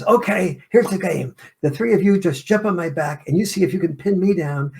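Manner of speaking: put-on rally the kids into a game; cheerful dad announcing a game to his kids; playful dad. Dad launching roughhousing game with kids.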